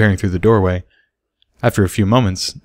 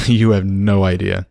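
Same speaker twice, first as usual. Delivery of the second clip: warm, amused; slower than usual. A narrator's reading. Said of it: "smiling down at her".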